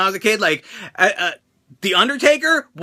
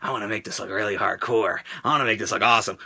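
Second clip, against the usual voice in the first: much lower, softer plotting murmur; scheming inner voice; 11 semitones lower, 7 dB quieter than usual. Retold or imitated. Wrestler deciding to blade, low soft scheming murmur. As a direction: talk yourself into it, low and conspiratorial. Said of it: "I was like".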